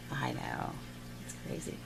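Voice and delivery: breathy whispered aside. Real, not acted. Teacher whispers an aside to viewers, whispered. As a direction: drop to a whisper for the joke.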